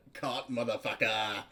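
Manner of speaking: laughing through the line. Acted. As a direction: blurt it out while laughing. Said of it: "that wasn't me either".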